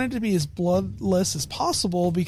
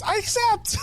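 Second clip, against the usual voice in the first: tiny, much higher squeaky bird voice; little bird creature. Retold or imitated. Bird fighter accepting the king's challenge, squeaky and high. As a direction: chirp it like a small bird accepting a duel. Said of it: "He goes".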